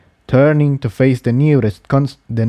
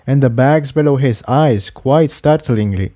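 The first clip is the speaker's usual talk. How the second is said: ordinary delivery, nothing marked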